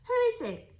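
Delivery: high, loud, jumpy cry; startled question. A narrator's reading. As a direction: jump up and call out. Jim startled in the dark.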